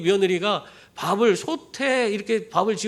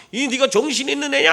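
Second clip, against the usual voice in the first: pitched up, berating mother-in-law; mock abusive scolding; 6 semitones higher than usual. Mother-in-law berates daughter-in-law, mock angry. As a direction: imitate someone laying into a relative. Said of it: "막 욕설을 퍼붓고".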